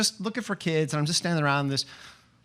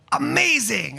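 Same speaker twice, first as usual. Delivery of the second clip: higher, bigger "Amazing" after a spin; dramatic reveal; 7 semitones higher, 3 dB louder, slower than usual. Shown as a demonstration. Asking the girl to spin and say "Amazing", showy and excited. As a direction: sell the word "Amazing" like a grand reveal. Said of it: "then spin and go".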